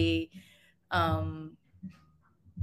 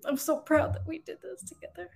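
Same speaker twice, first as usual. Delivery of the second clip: tearful pride; 8 semitones higher, 4 dB quieter than usual. Real, not acted. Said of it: "I'm so mad that I'm crying".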